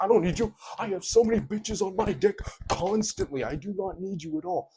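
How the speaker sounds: high, loud sneering guy impression; mock boastful yell quoting the typical guy; mocking bragging guy. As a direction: shout out the braggart's excuse. Mimicking the guys' go-to excuse.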